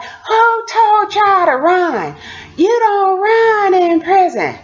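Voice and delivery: high, slow, exasperated scold. Retold or imitated. Scolding inmates for running, high and drawn out. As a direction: wail it in mock despair. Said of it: "I was like".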